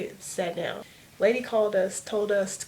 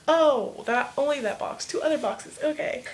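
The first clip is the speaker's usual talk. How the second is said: high, loud oh of understanding; sudden realization; 7 semitones higher, 4 dB louder than usual. Retold or imitated. Teen realizes what the eye examiner meant, surprised. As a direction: catch on all at once. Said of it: "I'm like".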